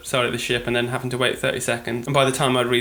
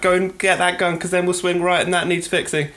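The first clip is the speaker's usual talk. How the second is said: higher-pitched imitated teammate shout; mock shouting; 5 semitones higher than usual. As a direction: call out hurried orders to a crewmate, only half shouting. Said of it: "and then also shouting, like".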